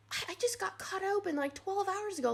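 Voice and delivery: as if they must be joking; incredulous protest. Retold or imitated.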